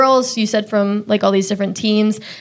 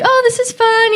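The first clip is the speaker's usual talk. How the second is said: much higher, chirpy naive delight; mock naive cheer; 14 semitones higher than usual. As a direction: sound happily clueless. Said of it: "we were like".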